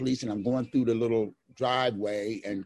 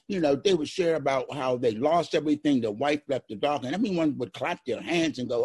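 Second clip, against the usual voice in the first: normal talking voice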